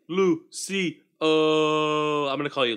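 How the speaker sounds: excited greeting. Real, not acted. Greeting viewer with nickname.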